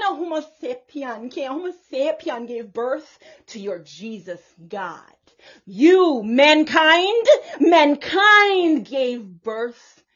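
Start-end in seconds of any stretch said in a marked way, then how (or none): 5.7-10.0 loud, slow and insistent; emphatic loud preaching. Real, not acted. Prophetess preaching to Christians, loud and emphatic. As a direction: hammer home each word.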